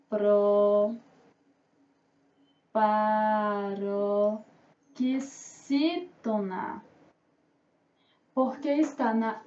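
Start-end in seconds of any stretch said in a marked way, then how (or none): 0.1-6.8 "Proparoxítona" very slow and low, broken by long pauses; drawn-out, low syllable dictation of "Proparoxítona". Shown as a demonstration. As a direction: dictate "Proparoxítona" one stretched syllable at a time. Teacher dictates the term by syllables.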